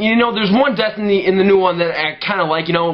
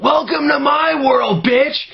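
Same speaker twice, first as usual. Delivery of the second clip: mock taunting heroine; 9 semitones higher, slower than usual. Retelling Freddy versus Jason, hammed-up triumphant taunt. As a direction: hammer out her triumphant line, slow and high. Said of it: "she's like".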